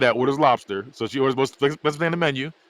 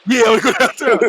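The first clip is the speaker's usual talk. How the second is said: hammed-up come-on; 9 semitones higher, 9 dB louder than usual. Mock seductive line.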